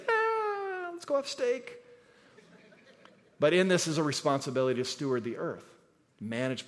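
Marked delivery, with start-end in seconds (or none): 0.1-1.8 squealed much higher, hammed-up glee; joking falsetto delight at steak; mock excitement. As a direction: play a giddy meat lover cheering. Congregation's mock meat enthusiasm.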